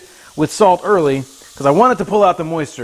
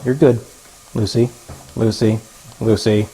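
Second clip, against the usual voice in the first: ordinary talk, nothing marked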